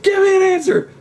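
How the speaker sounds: mock bully demand. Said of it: "'Cause the guy doesn't know what to say".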